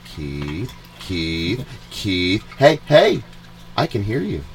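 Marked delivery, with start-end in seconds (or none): none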